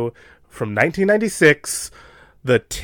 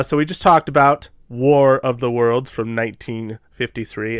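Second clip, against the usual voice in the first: ordinary delivery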